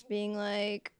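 usual speaking voice

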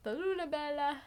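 put-on sing-song it like an old Italian grandpa; old Italian man. Friend joining in imitating the grandpa's Italian. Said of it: "sweetest little old Italian man".

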